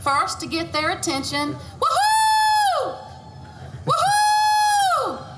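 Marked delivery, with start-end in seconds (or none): none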